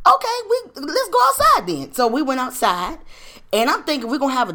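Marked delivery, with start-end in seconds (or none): 0.1-1.9 higher, louder, game-on dare; bold challenge. Retold or imitated. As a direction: call his bluff, high and loud. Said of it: "So I was like".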